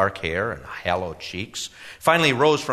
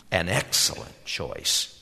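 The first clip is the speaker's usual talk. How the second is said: purring whisper; 4 semitones lower, 3 dB quieter, slower than usual. A narrator's reading. Salesman purring approval.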